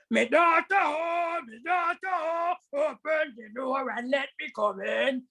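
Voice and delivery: slow, drawn-out tiger voice trying to sound like mother; rough tiger voice. Acted. Tiger at the cottage door calling the daughter, gruff and slow. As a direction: drag out me daughter like a gruff beast. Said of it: "And Mister Tiger said".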